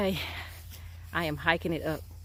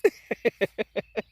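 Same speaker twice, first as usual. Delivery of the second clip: amused laugh; 5 semitones higher, 6 dB louder than usual. Real, not acted. Laughing at kids' joke.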